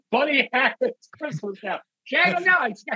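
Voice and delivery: high, loud hammed-up comedian voice; mock comic voice. Mock Buddy Hackett impression.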